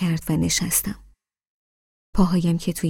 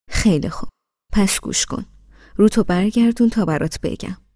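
usual speaking voice

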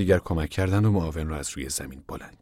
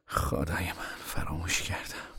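much softer, dismayed realisation; hushed, breathed out; 10 dB quieter, slower than usual. A narrator's reading. Tom realising to Casey he had forgotten, hushed. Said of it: "آهسته نفس کشید و گفت".

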